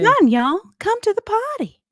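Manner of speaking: high, loud put-on host voice; hammed-up Tiana inviting riders; Tiana impersonation. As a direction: beckon like a cheerful princess. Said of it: "my Tiana impersonation".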